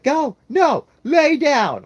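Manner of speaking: put-on very high, loud kid shriek; bossy screaming toddler. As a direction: shriek orders like a tantruming child. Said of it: "She's like".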